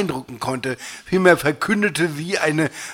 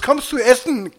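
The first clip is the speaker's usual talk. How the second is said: raised, high calling voice; 9 semitones higher than usual. A narrator's reading. Laura asks through the door about dinner, calling out. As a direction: call through a closed door. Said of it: "rief Laura durchs Holz".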